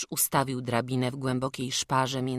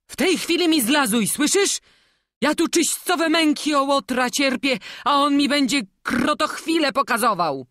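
indignant dressing-down; exasperated scolding; 12 semitones higher, 9 dB louder than usual. Acted. Master orders crying boy down, exasperated.

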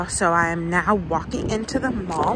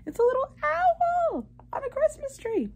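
cutesy high voice. Real, not acted. Cooing over cute socks.

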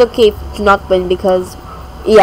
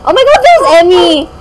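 excited spotting; 7 semitones higher, 8 dB louder than usual. Real, not acted. Spots her friend in game.

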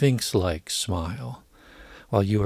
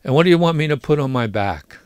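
quick, louder, higher-pitched retort; impatient; 5 semitones higher, 6 dB louder, faster than usual. A narrator's reading. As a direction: fire the question back impatiently. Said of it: "said impatiently".

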